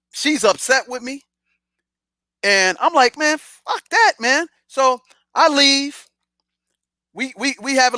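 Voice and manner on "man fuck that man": retold high-pitched defiance; defiant dismissal. Man to upset girlfriend after refusing the offer, defiant. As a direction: brush it off, voice jumping higher.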